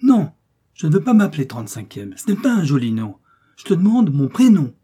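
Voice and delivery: put-on loud, high child's refusal; indignant protest. A narrator's reading. Little mouse refusing to be called a number, indignant.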